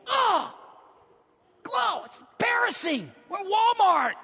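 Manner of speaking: put-on high, loud embarrassed girl with a laugh; girl embarrassed by sister's screaming. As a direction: groan at your sister in public. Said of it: "Laney goes".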